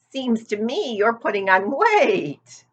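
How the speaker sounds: put-on a gossipy old sheep leaning in. A narrator's reading.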